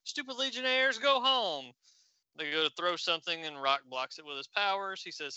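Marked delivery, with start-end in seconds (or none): 0.0-1.7 higher, shouted jeer at the heroes; mock crowd yell. Angry crowd jeers at the Legionnaires, mock yell. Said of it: "The crowd screaming".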